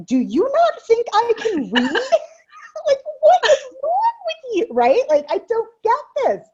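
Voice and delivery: high, loud, incredulous outburst; exasperated retort. Retold or imitated. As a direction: fire it back at him, fed up and half laughing. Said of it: "And I'm like".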